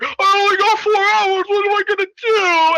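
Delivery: high, loud frantic wail; mock panic. Hero reporting to the agency, mock panic. Acting panicked Junie on call.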